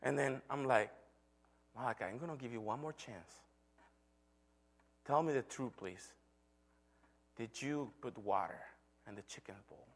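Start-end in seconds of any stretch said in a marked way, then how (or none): 1.7-6.1 hushed parental plea, dropping at the end; quiet, measured retold appeal; patient warning. Father gives son one last chance. 7.4-9.9 low, very soft, fading question; quiet retold last check, falling at the end; hushed questioning. Father asks one final time.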